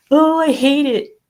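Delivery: loud, high mock whine of protest; mock outrage. Mimicking opponents of the slogan, mock outrage. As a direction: exaggerate a hater's outburst, high and loud.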